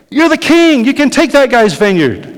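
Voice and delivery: high, loud scornful queen voice; mock incredulous Jezebel; Jezebel taunting. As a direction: goad the sulking king from above.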